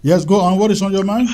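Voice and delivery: calling out. Real, not acted. Host raising voice to caller.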